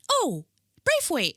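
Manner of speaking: high, loud Braithwaite with a long "ay"; mock people cheerily saying Braithwaite as "brayf-wait"; mimicked mispronunciation. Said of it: "and they're like".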